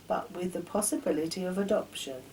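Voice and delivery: plain reading voice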